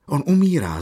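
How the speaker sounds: high, loud exclamation; alarmed cry. A narrator's reading. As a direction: cry out that a man is in danger. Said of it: "zvolal mladý důstojník".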